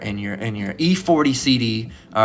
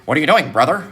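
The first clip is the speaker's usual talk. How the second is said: Dave Chappelle; 3 semitones higher, 4 dB louder than usual. Retold or imitated.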